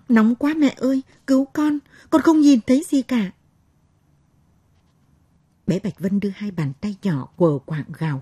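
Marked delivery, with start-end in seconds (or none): 0.1-3.3 put-on high, loud wailing child's cries; a scalded little girl sobbing for help; child crying for mother. A narrator's reading. Blinded little girl wailing to her mother, crying in pain. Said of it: "nó kêu khóc gọi mẹ".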